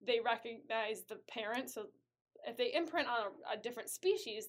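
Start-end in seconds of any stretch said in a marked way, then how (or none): none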